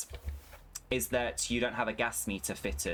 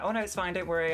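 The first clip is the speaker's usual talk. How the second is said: mock sweet call agent; 8 semitones higher, 3 dB louder, faster than usual. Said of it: "And she was like".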